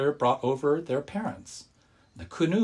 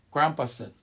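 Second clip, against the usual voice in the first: usual speaking voice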